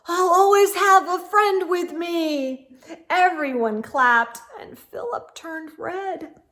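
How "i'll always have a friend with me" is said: sudden loud higher blurt. Acted. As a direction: burst it out all at once. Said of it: "blurted out".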